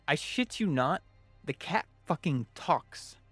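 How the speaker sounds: ordinary delivery, nothing marked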